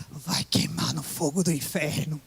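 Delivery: low, slow mock preacher's warning; mocking street preacher. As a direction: imitate the passing believer's doom line, slowly and low. Crentes passing by the book stall. (imitando o crente que passava, grave e devagar)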